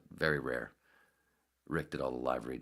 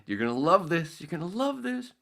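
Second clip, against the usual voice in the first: gleeful teasing; 14 semitones higher, 7 dB louder than usual. Retold or imitated. Suds gleeful quote.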